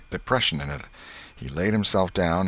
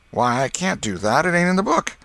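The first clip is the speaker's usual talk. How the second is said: protesting; 10 semitones higher, 4 dB louder than usual. A narrator's reading. Boys argue.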